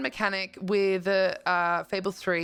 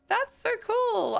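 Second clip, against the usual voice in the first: much higher, slow and drawn out; thrilled, stretched-out so cool; delighted; 4 dB louder, slower than usual. Real, not acted. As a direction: squeal it out with real delight. Delighted at game feature.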